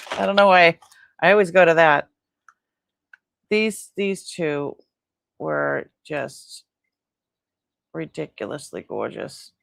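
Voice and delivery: usual speaking voice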